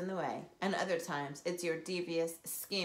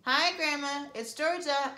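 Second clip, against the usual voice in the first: loud, high call through a door; 7 semitones higher, 5 dB louder, slower than usual. Acted. Girl calling through the door to grandma. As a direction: call out so she hears inside. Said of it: "I called out".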